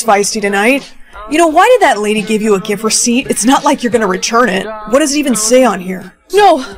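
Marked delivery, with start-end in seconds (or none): 6.3-6.6 high, loud cry of alarm. Acted. Stopping partner from reading the gift receipt, alarmed cry. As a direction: cry out to stop them.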